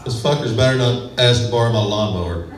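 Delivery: normal talking voice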